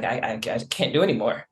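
low, soft, stammered imitation of giving up; retold exhaustion. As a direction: imitate someone tapped out, tripping quickly over the words. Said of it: "She was tapped out".

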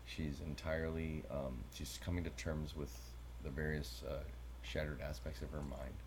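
low, soft, deadpan serious delivery; mock-clinical. Co-host feigns concern about her psychological issues, mock-clinical. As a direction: play the grave medical professional, quietly. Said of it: "you're so serious".

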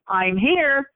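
lifted, reassuring announcement; announcing presence. Retold or imitated. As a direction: let them know you've come, voice lifted. Announcing herself to crying victims.

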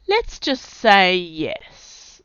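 drawl. A narrator's reading. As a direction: stretch it out lazily. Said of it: "said Sarge in a drawl".

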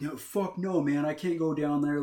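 high, loud imitation of panicked refusal; scared refusal. Kid refusing the dark corridor, acted-out alarmed protest. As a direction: balk loudly, acting out the fear. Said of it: "he's afraid of the dark".

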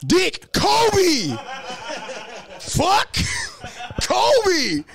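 high laughing shouts of Kobe; laughing Kobe yells. Retold or imitated. Comic mocking his cousin, high laughing Kobe shouts. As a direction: yell the name up high through laughter.

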